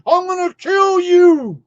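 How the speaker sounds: high, loud sneering mock threat; mocking a threatening believer. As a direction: parody the zealot rising to a shriek. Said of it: "I'm like".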